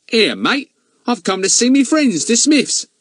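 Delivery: loud, high Cockney lad; brash Cockney put-on voice; Cockney accent. A narrator's reading. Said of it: "Fatty put on a real Cockney voice".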